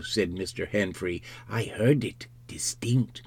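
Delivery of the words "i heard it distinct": hushed whispered confirmation; breath-only undertone; eavesdropper's whisper. Acted. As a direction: whisper it so those inside won't hear. Henfrey eavesdropping at the door.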